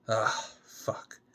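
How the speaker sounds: soft, voiceless sigh of a curse; a whispered curse to herself; whispered groan. Acted. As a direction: breathe it out alone and fed up. Gemma alone, dwelling, deflated.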